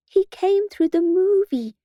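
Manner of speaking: put-on high, drawn-out child's wail; slow wail in a high pitch. A narrator's reading. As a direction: wail it like a frightened little child. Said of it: "wailed Tristan".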